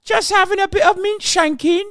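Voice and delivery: put-on high, loud caricature British accent; mock British authorities. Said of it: "the British authorities be like".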